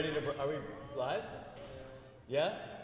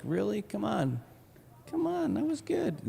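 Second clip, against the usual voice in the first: whiny protest; 9 semitones higher than usual. Retold or imitated. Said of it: "He's like".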